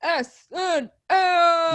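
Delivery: drawn-out hype shout; excited shout. Real, not acted. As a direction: belt out the show name slowly. Hyping the show name.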